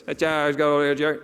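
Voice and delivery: garbled, low drive-through mock speech; unintelligible mumble. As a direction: mangle the words like an incomprehensible order taker. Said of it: "I have no idea what that man said".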